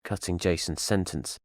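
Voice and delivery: ordinary delivery, nothing marked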